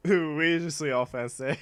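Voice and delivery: lightly hammed French accent, pretending to be hurt; mock offended French. Streamer voicing offended French chat, mock French accent. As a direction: play a huffy Frenchman.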